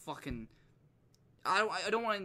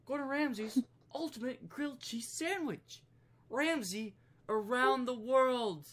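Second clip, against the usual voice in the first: high, drawn-out grand title read; show announcer; 9 semitones higher, 4 dB louder, slower than usual. Pretend. Host presenting a chef's cooking video, grand announcer. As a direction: announce it with mock grandeur, stretching the words.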